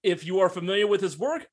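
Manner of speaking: usual speaking voice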